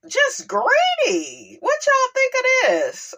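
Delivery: high, slow crying shout of disgust; drawn-out outraged wail; exasperated cry. Real, not acted. Outburst at ex-husband's demands.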